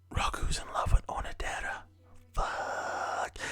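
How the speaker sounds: whispered imitation of a crushed girl; hushed realization. Girl overhears who her crush loves, hushed dismay. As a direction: whisper it as a stunned thought to yourself.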